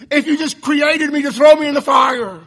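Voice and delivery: upset shout. Real, not acted. Preacher crying out against Calvinism, loud and upset. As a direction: throw it out in real anger. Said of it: "you see I'm getting upset".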